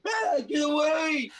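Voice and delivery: high, loud, drawn-out shriek. Acted. Game master voices startled foe behind a door, shrieking. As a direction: shriek it out to drive them away. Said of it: "you hear a shriek".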